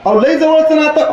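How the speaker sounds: high, eager asking voice; a nosy neighbour pressing the question; high-pitched questioning villager; curious villager. Acted. Acted dialogue, questioner.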